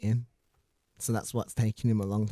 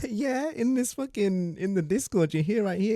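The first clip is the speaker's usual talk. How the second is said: laughing reply; 8 semitones higher, 3 dB louder than usual. Real, not acted. Laughing while directing drunk caller.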